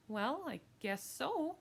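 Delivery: slow, high, drawn-out concession; reluctant agreement. Acted. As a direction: give in slowly, still unsure. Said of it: "My mother hesitated".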